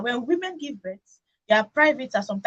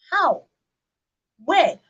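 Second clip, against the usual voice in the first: incredulous outburst; 5 semitones higher, 7 dB louder than usual. Real, not acted. Outraged at the husband.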